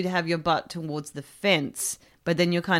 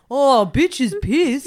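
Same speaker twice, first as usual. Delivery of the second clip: slow, odd dopey voice; mock drunk man; 4 semitones higher, 3 dB louder, much slower than usual. Friend mocks the idiot husband, slow dopey drawl. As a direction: play him as a dopey idiot. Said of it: "He's like".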